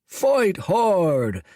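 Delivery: high rallying shout. A narrator's reading. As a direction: chant it back loud. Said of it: "shouted the dragons in the middle".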